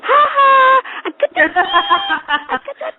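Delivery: put-on high, taunting little-kid voice; gleeful toddler. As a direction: crow it like a naughty toddler. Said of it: "And he goes".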